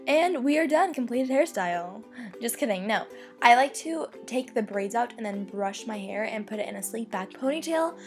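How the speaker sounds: ordinary talk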